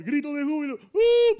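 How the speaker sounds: loud, high whoop; exultant cry rising to a sustained hoot; shout of jubilation. Shown as a demonstration. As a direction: demonstrate a triumphant cheer. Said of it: "hay que gritar".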